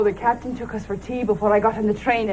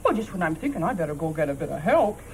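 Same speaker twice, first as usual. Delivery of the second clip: ordinary talk, nothing marked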